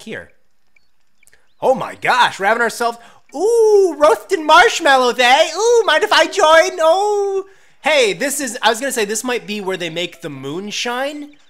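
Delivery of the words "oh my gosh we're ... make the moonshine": high flamboyant voice. Acted. Joining the cowboys in the back.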